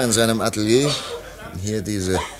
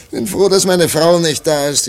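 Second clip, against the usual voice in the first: aufgeregt; 9 semitones higher, 7 dB louder than usual. Acted. Erleichterter Ausruf nach Lachen.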